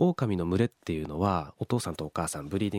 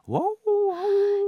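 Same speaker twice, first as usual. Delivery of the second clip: very high, loud, slow wolf yips; 6 dB louder, slower than usual. Retold or imitated. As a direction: howl it out like the answering pack. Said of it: "って今の声に応えてくれたんですね". (高く大きく、ゆっくり鳴く狼の声)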